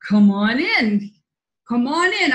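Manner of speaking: lightly voiced beckoning figure; a hint of a drawn-out, inviting summons; Grim Reaper. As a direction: beckon them in, lightly acted. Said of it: "he goes like this".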